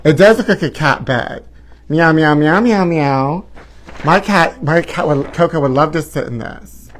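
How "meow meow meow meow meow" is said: playful mock meowing; cat meows. Imitating a cat curled in the hat. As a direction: meow like a cat in a bed.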